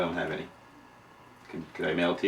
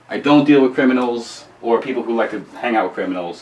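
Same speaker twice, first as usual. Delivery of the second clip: ordinary delivery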